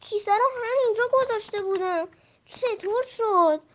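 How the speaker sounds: high, puzzled question; bewildered. Acted. Friend digs under the tree and finds nothing, baffled. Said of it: "با تعجب گفت".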